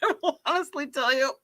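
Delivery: normal talking voice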